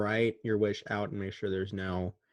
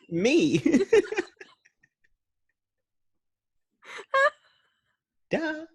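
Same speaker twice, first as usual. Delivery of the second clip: laughing. Real, not acted. Laughing, joking answer.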